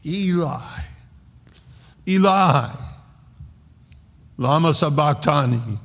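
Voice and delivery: high, loud cry imitating a scream; imitated scream. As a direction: cry out high, voice thrown into the silence. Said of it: "and he's screaming".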